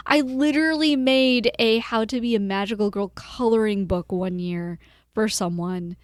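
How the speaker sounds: normal talking voice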